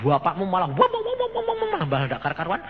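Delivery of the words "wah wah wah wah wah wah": very high, rapid wah-wah babble; mock raving babble. Preacher imitates father getting worse, high mock babbling. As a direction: imitate someone going wild, high-pitched.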